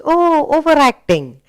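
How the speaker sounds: lifted, teasing tone; mock mocking remark. Shown as a demonstration. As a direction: tease someone who overdoes it. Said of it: "then you say".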